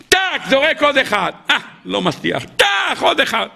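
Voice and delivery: straining climber. Acted. Climber straining to hammer peg.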